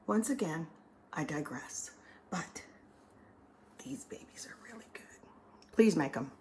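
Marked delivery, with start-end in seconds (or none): 1.1-2.6 unvoiced whispered aside; whispering. Real, not acted. 3.8-5.0 hushed whispered praise; whispering. Real, not acted. Whispered closing confidence.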